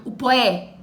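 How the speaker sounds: "o poé" slurred into one quick beat; quick elision. Shown as a demonstration. Teacher repeating the blend for students. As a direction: say "o poé" fast, vowels merged. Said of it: "Eu falo rápido".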